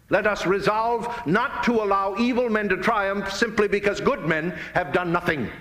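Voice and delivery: ordinary delivery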